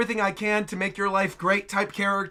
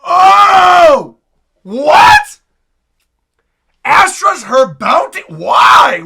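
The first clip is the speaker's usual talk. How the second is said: loud, incredulous shouting; shocked outburst; 5 semitones higher, 9 dB louder, much slower than usual. Real, not acted. Viewer learning Astra's her bounty, shocked shouting. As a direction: cry out in disbelief at a twist.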